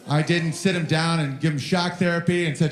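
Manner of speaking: ordinary talk, nothing marked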